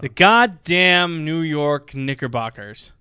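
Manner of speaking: loud, slow, drawn-out bellow; exasperated proclamation. Acted. Co-host kicking off a Knicks rant, bellowed exasperation. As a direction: belt it out high and stretch every word. Mock-outraged announcement of hated team.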